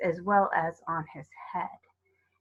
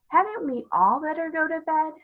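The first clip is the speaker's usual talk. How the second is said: put-on little girl's voice; 8 semitones higher than usual. A narrator's reading. Youngest girl pleads anxiously.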